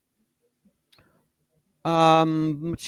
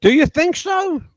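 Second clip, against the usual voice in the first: high-pitched mock-naive question; sarcastic; 13 semitones higher, 5 dB louder than usual. Real, not acted. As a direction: feign surprise with a loud, high sarcastic lift. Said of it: "I'm being sarcastic".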